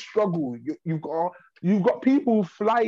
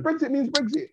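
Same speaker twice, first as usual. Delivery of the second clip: mocking slogan; 10 semitones higher than usual.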